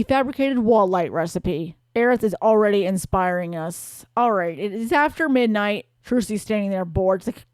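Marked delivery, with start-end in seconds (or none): none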